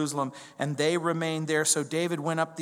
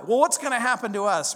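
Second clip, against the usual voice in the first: worried disciples; 6 semitones higher, 5 dB louder than usual. Retold or imitated.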